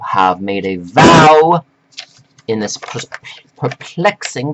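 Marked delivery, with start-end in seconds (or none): 2.5-4.5 stumbling over words through new dentures; tripping on the word with loose dentures; dentures in mouth. Real, not acted. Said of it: "I had to put dentures in my mouth, I could barely speak".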